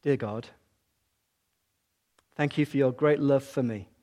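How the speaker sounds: slow, spaced prayer with long pauses. Shown as a demonstration. Preacher leading the congregation line by line, slow and hushed. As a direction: pray it line by line, leaving gaps. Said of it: "I'm gonna say it slowly".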